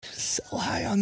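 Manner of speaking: slow, high, secretive whisper. Pretend. Conspiratorial aside about trading.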